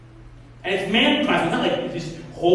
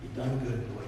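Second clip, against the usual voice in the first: tender praise; 8 semitones lower, 10 dB quieter than usual. Retold or imitated. Master praising his servant.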